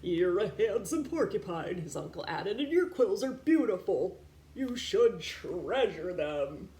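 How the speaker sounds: warm, slowing at the end; kindly uncle. Acted. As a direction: praise him tenderly. Uncle praises Elmore.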